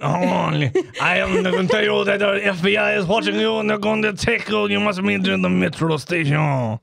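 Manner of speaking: high put-on foreign agent voice; mock menacing spy. Mock foreign agent warning the suspect at his door. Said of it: "A man in a foreign Midwestern accent".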